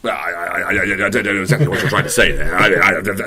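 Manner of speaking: politician. Pretend. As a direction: dodge the question like a minister. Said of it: "all politicians".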